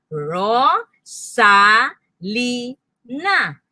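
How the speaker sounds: stamp out "Ro", "sa", "li", "na"; syllable by syllable. Shown as a demonstration. Teacher offering foot stamping, "Ro, sa, li, na" syllable by syllable. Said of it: "Pwedeng stamp your feet".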